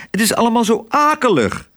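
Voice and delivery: high-pitched cry of complaint; distressed calling out. A narrator's reading. Otje complains to crow Kwark about her awful day, upset cry. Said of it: "riep ze tegen Kwark".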